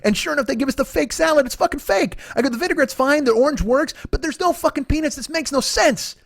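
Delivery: high-pitched exasperated rant; retold rant. Diner complaining to companion about the fake salad, ranting. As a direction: replay your indignant complaint, pitched high. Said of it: "I ranted for five minutes".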